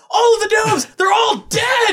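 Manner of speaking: high, loud mock wail; panicked shout. Jake pranking Finn, high panicked shout. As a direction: yell the bad news in fake panic. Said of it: "He's like".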